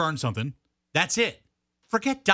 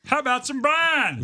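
higher, louder, slow drawled offer; mock pitchman; 8 semitones higher, 3 dB louder, slower than usual. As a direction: ham it up like an ad.

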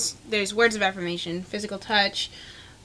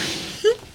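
giggle; 12 semitones higher, 3 dB quieter than usual. Real, not acted. Laughing at the either joke.

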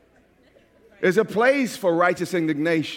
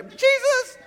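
exclaimed Jesus. Pretend. Mock outburst at Jesus' teaching.

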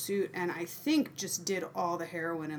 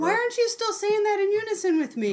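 mock complaint; 12 semitones higher, 5 dB louder than usual. Scolding co-host for failed unison.

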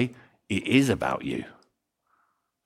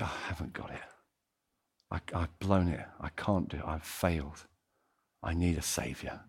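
much softer, near-whispered despair; defeated confession; 7 semitones lower, 12 dB quieter than usual. Retold or imitated. A sinner at the end of himself, hushed and broken. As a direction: admit you've blown it, barely audible.

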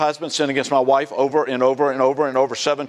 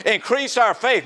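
higher-pitched, incredulous sarcasm; sarcastic; 6 semitones higher than usual. Acted. Apostles mockingly asking the Lord for more faith, sarcastic. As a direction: throw it out high, as a sarcastic demand. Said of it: "they're being very sarcastic".

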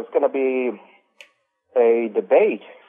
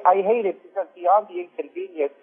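ordinary delivery

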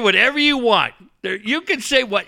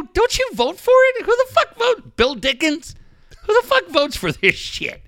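much higher, mock outraged questions; mocking rant; 10 semitones higher than usual. Host retelling how he needled the columnist, high mock outrage. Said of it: "I go".

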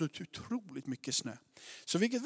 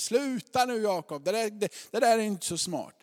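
raised, lightly acted telling-off; grandfather's reproof; 5 semitones higher, 6 dB louder than usual. Farfar to his son Jakob, mildly scolding. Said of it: "Och så kommer farfar ut där".

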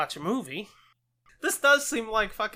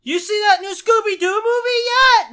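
high, loud taunting call; shouted question; 15 dB louder than usual. Retold or imitated. Neighbor calling over to the kid, loud and high. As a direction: holler the question down the street. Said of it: "He goes".

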